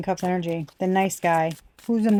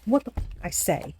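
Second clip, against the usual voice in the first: ordinary talk, nothing marked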